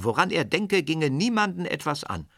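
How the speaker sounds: put-on a boy's mild defiant cry; calling out. A narrator's reading. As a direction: narrator lightly voices the boy's outburst. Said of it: "rief Serjoscha".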